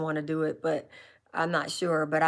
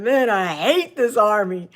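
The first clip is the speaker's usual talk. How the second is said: mock grumble; 3 semitones higher, 7 dB louder than usual. Retold complaint in basic training.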